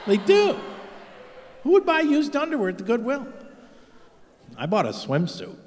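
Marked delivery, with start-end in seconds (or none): 0.1-3.3 high, loud disbelief; incredulous. Real, not acted. To the crowd about Goodwill underwear, high disbelieving squeal.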